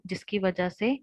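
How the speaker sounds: usual speaking voice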